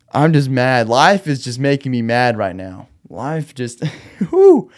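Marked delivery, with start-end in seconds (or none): 4.3-4.7 laughing exasperated whew. Real, not acted.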